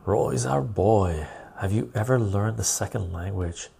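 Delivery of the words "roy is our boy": ordinary delivery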